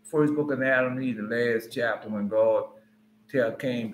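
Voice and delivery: ordinary talk, nothing marked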